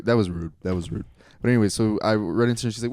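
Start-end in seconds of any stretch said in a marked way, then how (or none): none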